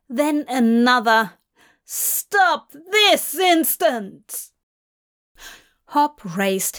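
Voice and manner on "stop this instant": commanding shout. Acted. Guards intrude on the ritual.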